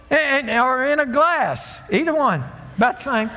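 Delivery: high, driving pulpit delivery; full-force preaching. Acted. Preacher rebuking scoffers before the congregation, full-force preaching. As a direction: preach it hard to the room.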